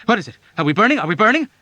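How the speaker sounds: high loud frantic questions; panicked cry. A narrator's reading. One-footed patient hopping and crying in panic. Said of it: "in panic, crying".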